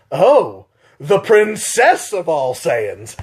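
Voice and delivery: slow, sneering villain voice, drawn out; Turles taunt. Retold or imitated. Turles mocking Vegeta after winning, slow drawn-out sneer. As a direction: savour the insult slowly. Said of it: "Turles looks at Vegeta and goes".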